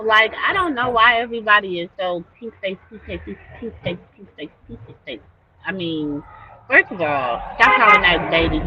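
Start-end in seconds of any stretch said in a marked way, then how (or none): 2.4-5.2 mocking mutter. Mocking repeated name.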